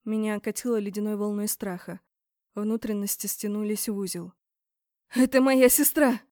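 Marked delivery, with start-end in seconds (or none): 5.1-6.2 loud, higher shocked cry; screaming. A narrator's reading. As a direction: shout it out in alarm. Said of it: "закричала Борн". (громкий крик)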